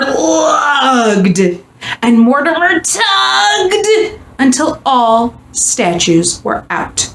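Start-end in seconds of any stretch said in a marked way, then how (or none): none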